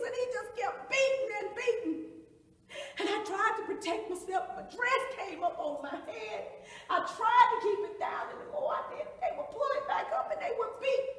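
high, loud, distraught telling; anguished account. Acted. Survivor trying to protect herself from blows, loud anguish. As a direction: keep the voice up in relentless distress. Reliving her own beating.

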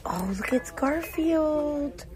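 high, drawn-out coo; real high-pitched delight; delighted discovery. Real, not acted. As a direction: coo slowly in a high voice, thrilled at a find. Delighted at spotting Garfield mug.

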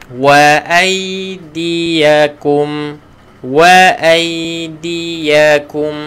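Wa aidiyakum drawn out, ya held still; teacher modelling Wa aidiyakum; slow recitation. Shown as a demonstration. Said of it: "matikan dengan ya".

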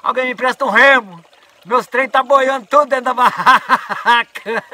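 high, loud, slow laughing shout; retold shouting. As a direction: yell for a paddle while cracking up. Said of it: "o Otto gritava".

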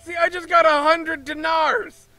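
mock outrage. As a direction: yell at the cheating merchant in pretend anger. Player yells at the merchant.

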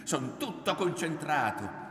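high, loud, heated argument; angry insistence. A narrator's reading. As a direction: drive the point home at her. Son keeps arguing angrily with mother.

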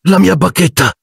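high shaken voice; stunned, a touch of tremble; dismayed high "mia"; trembling voice. A narrator's reading. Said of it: "disse Ron con voce tremante".

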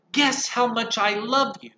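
high, eager little bunny voice; a lightly voiced little hare. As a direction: ask like an excited small child.

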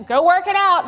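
high, loud curt brush-off; annoyed parent dismissing a tattling child; dismissive parent. Retold or imitated. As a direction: wave the kid away.

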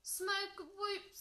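high-pitched little squeak. A narrator's reading. Dragon after his trick fails, squeaking. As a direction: squeak it apologetically. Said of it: "squeaked Sparky".

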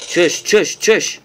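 mock-comic exclamation after a surprise; silly put-on voice. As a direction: bark it in a goofy voice. Exclamation in odd voice.

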